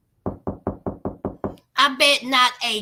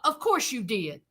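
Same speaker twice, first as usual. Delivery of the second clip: dry sarcastic aside, much softer; 5 semitones lower, 6 dB quieter than usual. Real, not acted. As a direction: roll your eyes as you say it. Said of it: "sarcastic aside".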